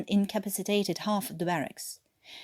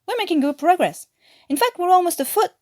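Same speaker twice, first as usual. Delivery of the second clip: excited; 10 semitones higher, 7 dB louder, faster than usual. Acted. Davis cheerfully reports tunnel progress.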